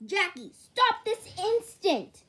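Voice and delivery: stern yell. Acted. Parent scolding child.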